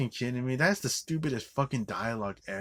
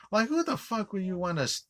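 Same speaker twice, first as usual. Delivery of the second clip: exasperated disbelief; 10 semitones higher than usual. Real, not acted. Exasperated rant.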